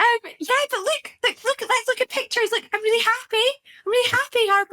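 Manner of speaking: high, sing-song reassurance; mock soothing mum. Mum retelling how she calmed her daughter, high coaxing voice. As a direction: hurriedly cheer the child up. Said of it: "I was like".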